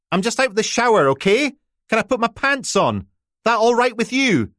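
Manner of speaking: put-on high, loud sarcastic teenage girl; stroppy teenager. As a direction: throw the sarcasm back through the door. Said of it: "sounding every inch the stroppy teenager".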